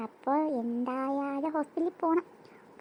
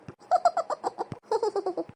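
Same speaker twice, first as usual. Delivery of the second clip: high falling laugh; 4 semitones higher than usual. Real, not acted. Sister laughing at her own joke.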